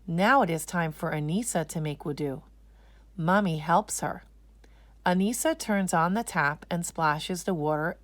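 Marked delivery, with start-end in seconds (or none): none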